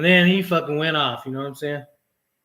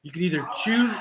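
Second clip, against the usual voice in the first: usual speaking voice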